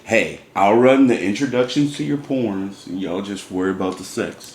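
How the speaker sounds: low announcer-style intro voice; deep porn intro narrator; Raw Star voice. Pretend. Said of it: "Is that your Raw Star voice?".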